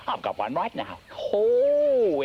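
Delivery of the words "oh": a loud sudden burst of delight; excited oh. Acted. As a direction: shout it as the idea hits. Sudden idea exclamation.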